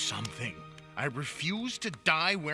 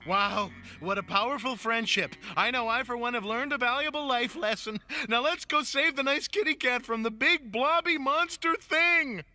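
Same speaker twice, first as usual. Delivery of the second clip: exaggerated gushing; 7 semitones higher than usual. Urging friends to save the cat, exaggerated gushing. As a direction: gush higher and higher into a rallying cry.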